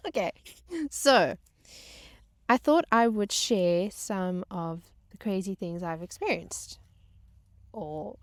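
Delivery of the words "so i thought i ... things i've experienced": normal talking voice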